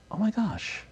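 hushed, high-pitched "moved" reaction; recalled soft gasp of amazement; emotional awe. Retold or imitated. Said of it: "I got emotional".

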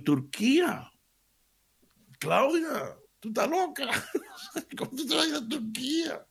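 high, laughing "you're crazy" to his daughter; laughing disbelief. Retold or imitated. Father reacts laughing to his daughter's news of Turkey. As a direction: squeal it out half laughing.

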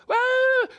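high, loud imitated kid's scream; sudden piercing mock squeal; mock child's shriek. Adult imitating a noisy child, mock shriek.

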